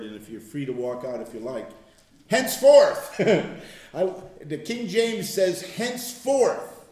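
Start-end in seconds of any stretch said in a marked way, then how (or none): 2.3-3.2 "Henceforth" punched loud and high; stressed key word. Real, not acted. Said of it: "I've stressed this word". 5.6-6.8 "Henceforth" drawn out slowly and high; stressed key word. Real, not acted. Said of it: "I've stressed this word".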